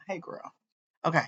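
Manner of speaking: ordinary delivery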